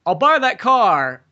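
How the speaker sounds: loud, high, drawn-out mock offer; hammed-up slow proposition; stranger making an offer. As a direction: stretch each word like a showy buyer. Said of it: "some guy comes up to him and goes".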